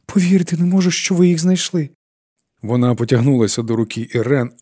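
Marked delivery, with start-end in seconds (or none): 0.0-1.9 високий зворушений голос; з радісною недовірою, високо; радість крізь сльози. A narrator's reading. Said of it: "усміхаючись до Ірен клі-, крізь сльози". (зворушено, не вірячи своєму щастю)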